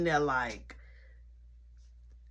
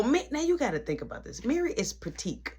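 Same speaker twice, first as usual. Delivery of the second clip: ordinary talk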